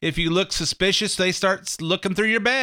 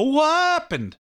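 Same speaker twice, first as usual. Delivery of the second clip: high, squeaky what happened; comic catchphrase; 11 semitones higher, slower than usual. Retold or imitated. Host imitating a TV comedian's catchphrase, high and squeaky. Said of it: "He always go".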